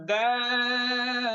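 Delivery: teacher modelling দাল for the class to repeat; model pronunciation. Shown as a demonstration. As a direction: draw out দাল slowly for students to copy. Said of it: "সকলেই খেয়াল করেন".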